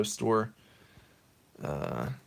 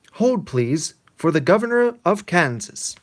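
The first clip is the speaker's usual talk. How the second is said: high, louder mock operator; prim operator on the line; phone secretary voice; 8 semitones higher, 7 dB louder than usual. Secretary on the phone line, hammed-up operator voice. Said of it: "coming from the phone, it says".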